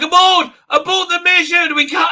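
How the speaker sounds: high, loud mock panic shouting; hammed-up frantic command; captain yelling in mock panic to abort; panicked order.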